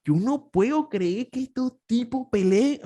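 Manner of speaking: high, slow, incredulous; drawn out as if he can't believe it; disbelief. Retold or imitated. Watching Argentine TV debates, high and slow in disbelief. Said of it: "yo decía".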